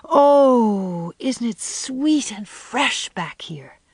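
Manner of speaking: slow, hushed and airy after an opening sigh; breathed. A narrator's reading. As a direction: breathe it out in wonder. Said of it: "breathed Anne".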